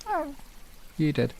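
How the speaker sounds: ordinary delivery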